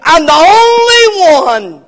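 high, loud, breaking lament; despairing cry. Pretend. Elijah lamenting to God that he is alone, near tears. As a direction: let it crack into a cry.

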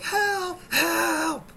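high, loud drawn-out calls; screaming for help. Retold or imitated. Bitten man calling to watching neighbors, screaming for help. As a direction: holler for rescue in a high voice.

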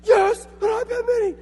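very high, strained squeal of triumph; ecstatic child. Retold or imitated. Son opening his iPad, high-pitched ecstatic shout. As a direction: squeal it like a kid unwrapping a dream gift. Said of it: "first thing that we hear, he's like".

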